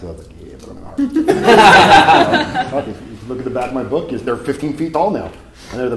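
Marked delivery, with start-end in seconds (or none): 0.4-1.3 high, giggling, half-whispered; cheeky laughing whisper; laughing reply. Retold or imitated. His cheeky reply to parents. 1.3-2.6 loud high burst of laughter; real loud laugh; big laugh. Real, not acted. Laughing at his own story.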